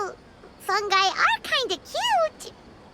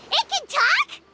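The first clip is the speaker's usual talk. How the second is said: high-pitched, startled question; shocked; 7 semitones higher, slower than usual. Acted. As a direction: blurt it out in disbelief. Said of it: "just as shocked as you are".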